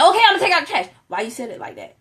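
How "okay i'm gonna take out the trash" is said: loud, high, put-out reply; grudging, irritated okay; husband sulkily agreeing to take out the trash; sullen husband. Retold or imitated. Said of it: "And he be like".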